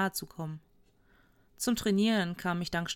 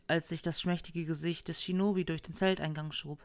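ordinary delivery, nothing marked

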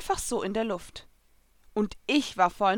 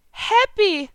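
higher, louder happy call; glad cry; 13 semitones higher, 8 dB louder than usual. A narrator's reading. Lucy greeting the cat, glad to see him. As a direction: call out in delight. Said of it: "rief ich erfreut".

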